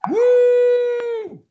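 long, high, loud "woo"; cheering squeal, held; excited whoop. Real, not acted. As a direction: let out a sustained high whoop. Cheer at the initiative roll.

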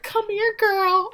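high-pitched mock-affectionate call; acted friendly beckoning. Friend calls her over to hug, acted high and gushy.

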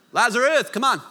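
impatient summons to the dead friend; mock call out. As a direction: call Lazarus out of the tomb like it's nothing. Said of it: "he's like".

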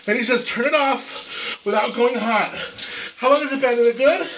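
yelping, gasping under icy water; high, breathless shock at the cold; cold shower shock. Real, not acted.

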